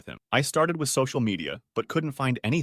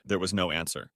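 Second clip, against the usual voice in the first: ordinary delivery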